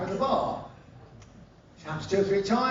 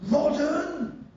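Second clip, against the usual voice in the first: mock astonishment; 10 semitones higher, 4 dB louder, slower than usual. Villain astonished at his degree.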